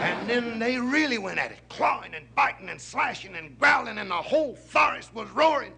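high, loud, mounting storytelling; excited fight narration. A narrator's reading. Storyteller describing lion and tiger fighting, excited and loud. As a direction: pile up the blows at full voice.

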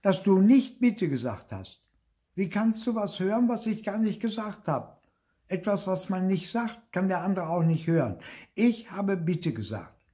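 high-pitched sibling squabble; high, stubborn bickering; childish arguing. A narrator's reading. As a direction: argue back in a high, insistent child's voice. Siblings quarrel over saying please.

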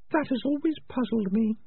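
high, sharp little rat voice; Peaches voice. Acted. Peaches tells Morris, in a high rat voice.

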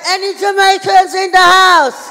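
very loud, high, drawn-out holler; bigger crowd shout. Real, not acted. Comedian repeating the question to audience, full drawn-out shout. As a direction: belt it slowly to rouse the room. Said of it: "I said".

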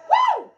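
very high, loud "woo"; piercing falsetto whoop. Real, not acted. Player celebrates a pitch, high whoop.

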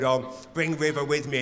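ordinary talk, nothing marked

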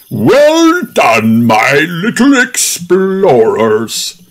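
cheering. A narrator's reading. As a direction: cheer the kids on. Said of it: "cheers Grampy Rabbit".

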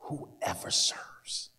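whispered, slow; whispered emphasis. Shown as a demonstration. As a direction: breathe the words out. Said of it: "You hear it?".

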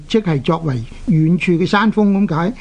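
normal talking voice

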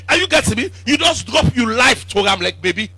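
normal talking voice

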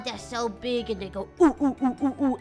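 normal talking voice

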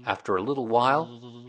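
ordinary delivery

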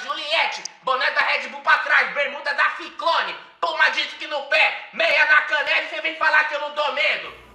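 loud offended protest; indignant shouting. Acted. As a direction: yell it, deeply insulted. Suspect outraged at being called harmless.

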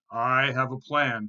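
big billy goat's loud declaration. A narrator's reading. Big goat tells his brothers he has a plan, loud voice. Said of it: "in his loud voice".

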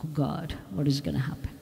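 low, very soft worried murmur; hushed dread. Acted. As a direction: shrink back and say it under your breath. Acts out the frightened listener's reaction.

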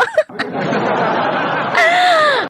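shrieking cackle, way up high; high screaming laugh. Real, not acted. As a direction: howl with laughter. Laughing at rival's anger.

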